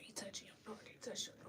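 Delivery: soft, unvoiced, shaken; a shaken whisper; hushed whisper. Real, not acted. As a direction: say it as if you can't bear to. Repeating the terrible news.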